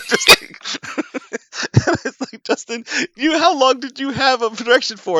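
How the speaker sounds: high, loud words squeezed out through laughter; crying with laughter as he asks; laughing hard. Real, not acted. Laughing while retelling his question.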